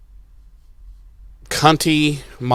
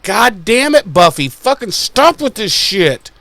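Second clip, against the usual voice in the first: higher, fed-up scolding; exasperated rant; 6 semitones higher than usual. Retold or imitated. Viewer scolding Buffy for teasing Spike, exasperated. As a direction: scold her like you've had enough. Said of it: "I'm like".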